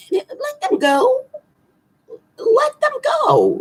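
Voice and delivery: very high, slow sing-song plea; high pleading. Acted. Astrologer urging Taurus readers to let their partner go. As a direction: stretch it out high like a mock lament.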